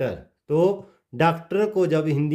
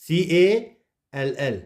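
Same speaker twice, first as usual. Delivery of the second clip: usual speaking voice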